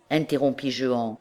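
ordinary delivery, nothing marked

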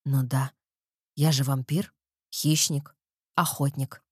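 low, menacing predator voice; dark lord. A narrator's reading. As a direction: savor each word like a hunter. Erik names himself predator.

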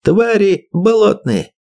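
high slow furious shout. Acted. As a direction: bellow the insult. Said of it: "закричал Ерофеев".

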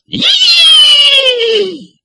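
sudden high startled call; a sharp startled yelp; high startled exclamation; surprised cry. Acted.

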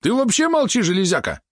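very high, angry snap; snarling. A narrator's reading. Valeron turns on the spider, high-pitched snarl. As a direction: snap at the robot in a squealing pitch. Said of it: "ощерился на него Валерон".

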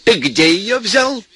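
mother-in-law shouting at Venya about firewood. Acted. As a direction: hurl the accusation, drawing it out. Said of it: "вернулась и закричала".

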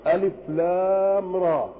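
"ألف لام را" recited as separate letter names, lam long drawn out. Shown as a demonstration. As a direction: stretch the middle of "ألف لام را" slowly, reciting. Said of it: "أنها تنطق بأسماء الحروف".

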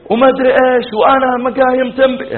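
high, ranting mock protest; imitated wife's outcry. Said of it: "إلا صرخت وخذت غطوتها". (تقليد احتجاج الزوجة بصوت عال)

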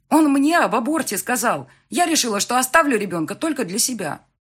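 high, loud indignant outburst; heated self-defence. Acted. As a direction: defend yourself hotly. Daughter flares up, defending her decision. (запальчиво)

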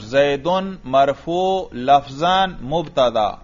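زید مرفوع لفظاً مبتدا said slowly, with the endings sounded as zaidun marfu'un; slow teacher demo of the case endings; voicing the case endings. Shown as a demonstration. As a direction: model the nunation on زید, slow and clear. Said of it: "اس لفظ پہ بھی وہی پڑھا کریں اعراب".

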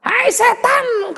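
high, slow taunt at the devil; defiant call. Acted. Paul taunting the devil, in a high slow call. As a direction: stretch it out, daring the devil.

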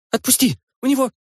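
high, sharp cry to let go; urgent cry. Acted. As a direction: shout it, cut off mid-word. Grabbed while chasing thief.

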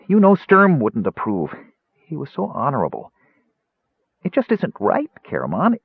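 high, halting, upset Kender; quivering voice. A narrator's reading. Tass confesses his doubts to Caramon, quivering voice. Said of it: "continued in a quivering voice".

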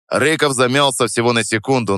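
plain reading voice

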